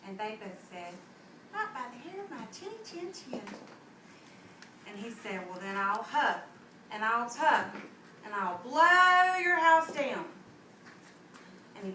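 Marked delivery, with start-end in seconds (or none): 1.5-3.4 high squeaky little pig voice; small defiant pigs. Acted. Two little pigs refusing the wolf, high and small. 5.5-10.3 building wolf threat. Acted. Big bad wolf threatening the pig, building to a roar.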